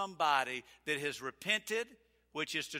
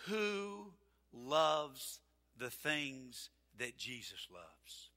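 slow question; 3 semitones lower, 6 dB quieter, much slower than usual. Shown as a demonstration. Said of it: "slow searching question".

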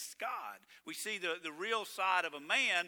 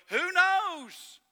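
high, loud mock shrug of a question; sneering high who knows; mocking eulogist; 12 semitones higher, 9 dB louder than usual. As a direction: toss it off like a clueless eulogist. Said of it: "and go".

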